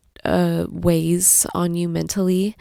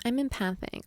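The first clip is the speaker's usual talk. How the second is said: mocking what people say to her; a touch of eye-roll on the quote; quoting others with light sarcasm; 4 semitones higher, 7 dB quieter than usual. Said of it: "We hear that all the time".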